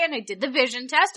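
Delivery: normal talking voice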